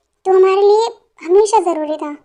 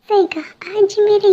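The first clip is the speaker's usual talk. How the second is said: whisper; 4 semitones higher, slower than usual. Acted. Friend agrees in hushed aside.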